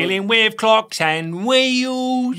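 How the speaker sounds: high, very slow singsong Cockney; mock Cockney Bert. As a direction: croon it like Bert the chimney sweep. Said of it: "Bert from Mary Poppins".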